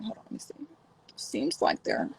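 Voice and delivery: whispered aside, unvoiced; mock-serious whisper. Streamer pretending to check her contacts, whispering.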